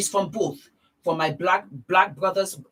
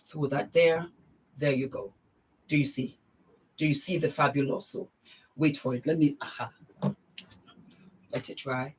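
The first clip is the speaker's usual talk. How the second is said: ordinary talk, nothing marked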